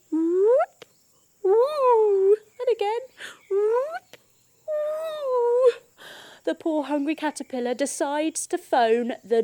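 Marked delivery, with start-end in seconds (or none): 0.1-5.7 high excited whoops. Acted. Teacher to kids, rocking and rolling, high excited whoops. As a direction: squeal it out while rocking.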